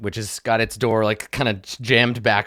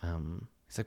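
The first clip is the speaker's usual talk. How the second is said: hushed, almost unvoiced lead-in; whispered narration; 5 semitones lower, 16 dB quieter than usual. A narrator's reading. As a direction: whisper the set-up like a secret.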